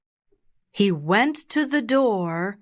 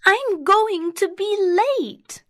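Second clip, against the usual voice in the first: put-on very high child's voice; high-pitched, fretting storybook girl; dismayed little girl; 13 semitones higher, 3 dB louder than usual. A narrator's reading. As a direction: worry aloud in a high girlish voice. Girl realises she is late.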